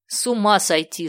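plain reading voice

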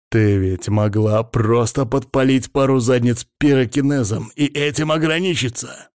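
ordinary delivery, nothing marked

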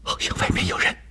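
soft, whispered warning; hushed voice. A narrator's reading. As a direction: whisper so no one outside hears. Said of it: "她压低嗓门说". (压低嗓门，悄声提醒)

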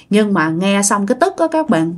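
ordinary delivery, nothing marked

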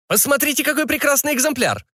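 high-pitched, eager sales pitch; market barker. Acted. Barker touting wares to an important fat customer, high-pitched sales patter. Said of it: "обратился худой как щепка зазывало".

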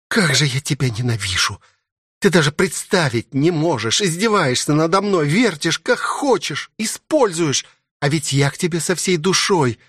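hurt, furious princess. Acted. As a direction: pour out wounded accusations as the princess.

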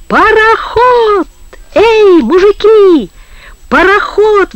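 high, drawn-out shout; sudden yell. A narrator's reading. Коля База calls to the men from the stern, yelling. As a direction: holler it out to rouse everyone aboard. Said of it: "вдруг завопил на корме Коля База".

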